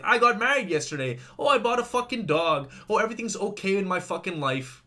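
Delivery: mock-cheerful social media poster; mocking social media posts. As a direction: parody fake happy updates. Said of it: "people hide behind social media".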